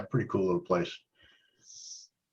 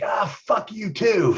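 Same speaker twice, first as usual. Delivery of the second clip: mocking retort; 11 semitones higher, slower than usual. Chris mouthing off to manager.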